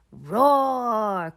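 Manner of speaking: put-on a toddler's playful roar; pretend dinosaur roar. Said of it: "cries George".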